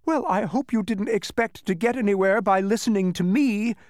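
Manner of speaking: high, gloating character voice; gleeful. Acted. Tricky voice to trapped travelers, gleeful. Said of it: "said the voice gleefully".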